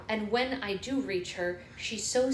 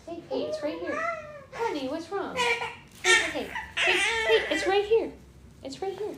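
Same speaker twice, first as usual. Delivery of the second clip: high soothing; 13 semitones higher, 4 dB louder than usual. Real, not acted. Mother soothing her upset baby, high and bright. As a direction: lift the voice up high to calm her.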